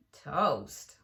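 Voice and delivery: low, grumbling toast. Acted. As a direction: scoff at the word. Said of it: "grumbled Eugenia".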